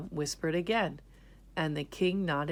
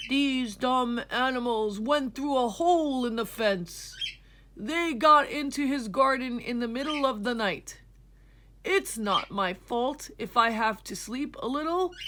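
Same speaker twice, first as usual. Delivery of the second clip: higher, defensive grumbling shepherd; irritated, excuse-making shepherd; angry shepherd; 6 semitones higher, 3 dB louder than usual. Acted. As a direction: blame the sheep, annoyed. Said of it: "The shepherd was angry too".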